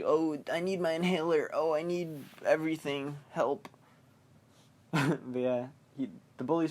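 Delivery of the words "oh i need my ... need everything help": lightly voiced fretful germaphobe; a mock plea from a needy hypochondriac. Germaphobe Eddie begging for his inhaler, lightly mocked fretting. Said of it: "He's, like, the germaphobe".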